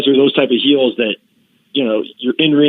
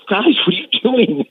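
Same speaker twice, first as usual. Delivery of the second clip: much higher, baffled boss voice; Bruce Pritchard, baffled; 10 semitones higher than usual. Retold or imitated. Backstage boss sees the costumes, baffled high voice. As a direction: squeak it out like a puzzled boss. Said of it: "And Bruce Pritchard going like".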